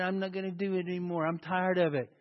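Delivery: quick, low-key grumble; hurried, muted complaint; fed-up quitter. Acted. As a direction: run it together softly like giving up. Voices a weary quitter.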